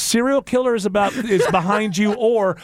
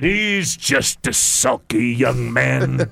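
lower, slow dramatic aside; melodramatic; 5 semitones lower, much slower than usual. Acted. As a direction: play it like a musical. Mock musical scene narration.

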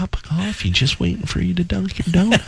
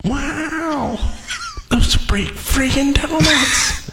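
slow, higher, ringing echo; echoey reverb; 8 semitones higher, slower than usual. Acted. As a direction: drawl it out in an echoey hall. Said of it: "Why it's so echoey in here".

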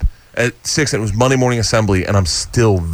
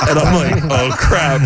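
laughing; 5 semitones higher, slower than usual. Real, not acted. Realizing beer at school.

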